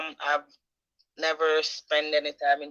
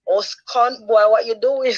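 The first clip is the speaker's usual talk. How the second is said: loud, slow Jamaican scold at her son; 8 semitones higher, 8 dB louder, slower than usual. Retold or imitated. Mother yelling at her son in Jamaican patois, loud and slow. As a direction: shout it out like a fed-up mum. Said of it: "I'm like".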